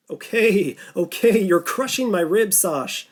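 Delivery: joking. Acted. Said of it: "jokingly said".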